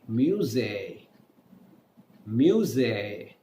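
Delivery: ordinary delivery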